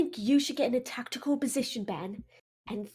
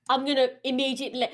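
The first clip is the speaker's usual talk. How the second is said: usual speaking voice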